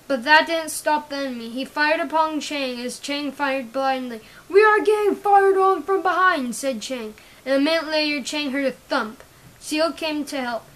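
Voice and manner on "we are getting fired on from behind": loud, higher, alarmed report; urgent shout. Acted. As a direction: call it out while under fire. Soldier reports fire under attack.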